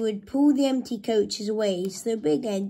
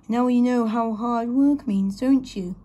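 louder, mildly teasing gloat; chuckling; 3 semitones higher, 5 dB louder than usual. A narrator's reading. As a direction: tease with a touch of triumph. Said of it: "chuckled Gordon as he passed".

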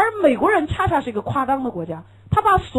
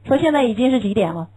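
ordinary delivery

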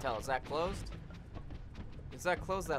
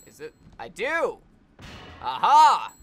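normal talking voice

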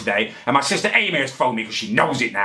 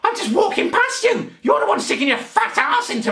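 laughing outraged shout; 13 semitones higher than usual. Real, not acted. Outraged retort in the shop.

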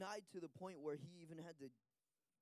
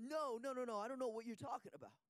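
high, hurried protest; freaked-out denial; 6 semitones higher, 3 dB louder than usual. Retold or imitated. Interpreter voices Peter panicking at the girl, higher. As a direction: back away in panic. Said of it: "Peter freaked out and said".

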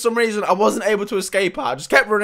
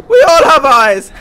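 louder, higher, building to a laugh; mock cheery declaration; 4 semitones higher, 9 dB louder than usual. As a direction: declare it loudly to everyone. Mock chant about having eyes.

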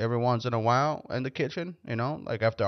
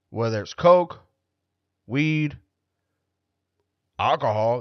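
ordinary talk

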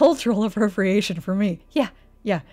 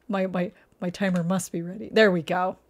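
usual speaking voice

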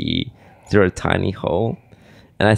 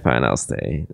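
usual speaking voice

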